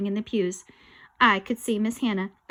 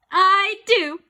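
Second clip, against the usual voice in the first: loud, higher "I do"; eager bride; 9 semitones higher, 9 dB louder than usual. Acted. Ghost bride answering the vows, loud. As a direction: say it gladly.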